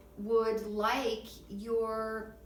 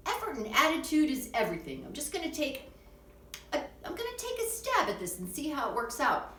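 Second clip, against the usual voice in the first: upbeat self-talk; 6 semitones higher, faster than usual. Acted. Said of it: "maybe say to yourself things like".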